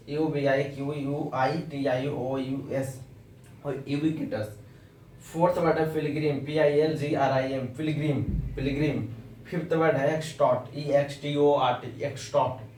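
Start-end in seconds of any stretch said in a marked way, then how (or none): none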